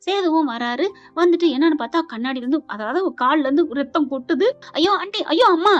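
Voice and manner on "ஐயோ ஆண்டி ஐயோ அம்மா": higher acted cry of dismay; alarmed cry. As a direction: exclaim in mock panic.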